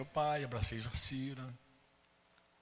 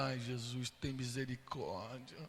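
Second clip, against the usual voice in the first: a soft, lowered "ai, Jesus" under the breath; quiet anxious plea; 4 semitones lower, 8 dB quieter, slower than usual. Retold or imitated. Retelling his dread as the stepmother nears the car, softly pleading. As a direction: murmur it like a nervous prayer.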